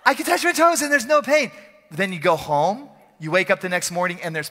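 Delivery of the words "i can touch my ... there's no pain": 1 excited churchgoer. Retold or imitated. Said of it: "You'll be at church and like".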